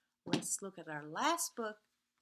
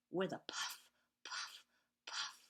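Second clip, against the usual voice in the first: whispered; 2 semitones lower, 4 dB quieter than usual. A narrator's reading. Whispered train refrain.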